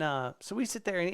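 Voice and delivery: normal talking voice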